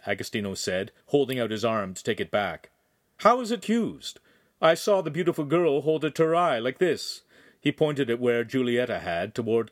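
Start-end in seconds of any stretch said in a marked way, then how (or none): 3.2-7.2 higher-pitched, imperious curiosity; demanding noble. Acted. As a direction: question them as a haughty lord.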